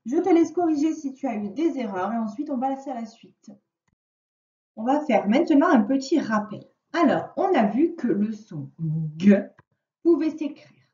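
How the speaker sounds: usual speaking voice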